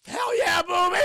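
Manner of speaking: loud, high, whooping shout; excited cheer. Retold or imitated. Aang accepts his friend's offer, excited cheer. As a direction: burst out with joyful approval.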